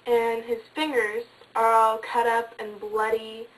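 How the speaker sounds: usual speaking voice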